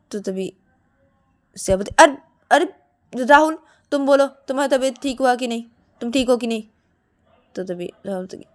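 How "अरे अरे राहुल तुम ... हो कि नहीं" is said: raised, louder questioning; insistent appeal. Acted. Siya asks Rahul to confirm he is well, insistent. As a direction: press him for an answer.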